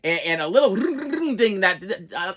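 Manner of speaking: ordinary talk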